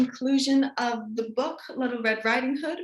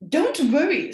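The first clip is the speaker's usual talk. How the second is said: snarl; 4 semitones higher, 6 dB louder than usual. Acted. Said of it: "snarled the wolf".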